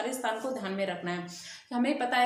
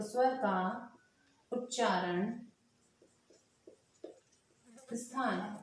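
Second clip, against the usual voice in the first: dictation pace; 5 dB quieter, slower than usual. Shown as a demonstration. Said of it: "इसे लिख देते हैं".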